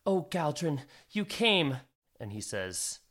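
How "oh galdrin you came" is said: higher, surprised woman's voice; a mother startled to see him at the door; mother's surprised greeting. Acted. Mother greets hooded visitor.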